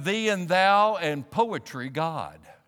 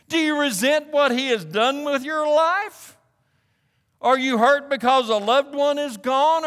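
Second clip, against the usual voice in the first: high, raised, urgent pulpit voice; impassioned preaching; 8 semitones higher, 4 dB louder than usual. Real, not acted. Preacher urging listeners to be honest with God, impassioned. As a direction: drive each question home to the congregation.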